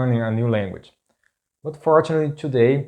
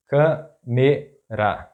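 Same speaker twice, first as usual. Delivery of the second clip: câmera slowly split, câ - me - ra; syllable by syllable; much slower than usual. Shown as a demonstration. Teacher breaking down an example word, câmera slow in syllables.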